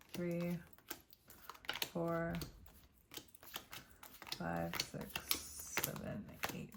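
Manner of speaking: counting the cards in a whisper. Real, not acted. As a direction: whisper the numbers to yourself. Counting cards under her breath.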